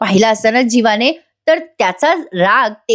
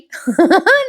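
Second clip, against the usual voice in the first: short high laugh; amused giggle; 10 semitones higher than usual. Real, not acted. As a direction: let out a real, high giggle. निवेदिका स्वतः हसते. (छोटं, उंच हसू)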